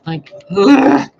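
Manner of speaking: loud groan of exasperation; frustrated ugh. Real, not acted. Fed up with everything itching, a loud groan. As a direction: let out a big fed-up ugh. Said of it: "Everything just".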